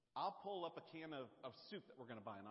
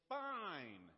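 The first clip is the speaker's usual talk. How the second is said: wife yelling back; 4 semitones higher, 5 dB louder than usual. Retold or imitated. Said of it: "she will yell back".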